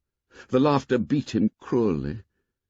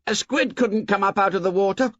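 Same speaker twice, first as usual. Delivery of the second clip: put-on boy voice; 10 semitones higher than usual. A narrator's reading. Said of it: "Someone shouted".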